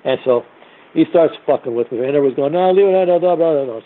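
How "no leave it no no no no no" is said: imitated pleading bystanders. Imitates bystanders trying to calm things.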